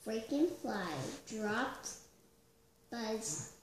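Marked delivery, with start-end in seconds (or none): none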